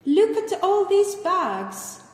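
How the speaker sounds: loud, high, drawn-out exclamation; amazed exclamation. Acted. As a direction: exclaim slowly over the shopper's haul. Same greeting, played a second time.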